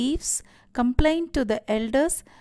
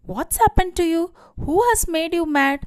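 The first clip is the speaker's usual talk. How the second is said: higher-pitched, alarmed acted questions; crying out; 6 semitones higher, 4 dB louder, faster than usual. A narrator's reading. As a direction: demand answers in mild panic. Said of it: "she cried out".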